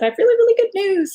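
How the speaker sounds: high, bright-eyed rush of good news; excited news. Retold or imitated. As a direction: deliver the good news with a jump up in pitch. Editor's excited phone call quoted.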